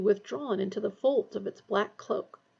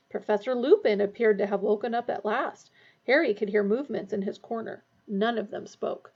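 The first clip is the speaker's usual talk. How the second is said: usual speaking voice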